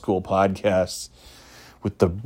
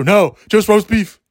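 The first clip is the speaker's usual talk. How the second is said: stubborn local; 10 semitones higher, 5 dB louder than usual. Retold or imitated. Locals' stubborn answer voiced.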